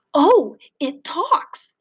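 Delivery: slow, high, amazed gasp of words; astonished. Acted. Freak amazed the big kid can talk, slow and high. Freak surprised Max speaks.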